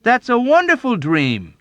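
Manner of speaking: high, loud delight; soaring, emphatic approval; enthusiastic declaration. A narrator's reading. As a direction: declare it with gusto. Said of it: "Dunbar declared".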